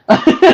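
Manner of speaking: short high-pitched laugh. Real, not acted. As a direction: burst into a brief, high laugh. Laughs at own reaction.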